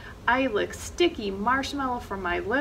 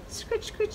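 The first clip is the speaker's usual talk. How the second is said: scratch sound; 11 semitones higher, 4 dB quieter than usual. A narrator's reading. Imitating fox paws scratching.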